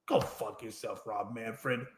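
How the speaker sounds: quiet disdainful send-off; low contempt. Real, not acted. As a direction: drop to a low, hushed sneer. Host insults the commissioner directly.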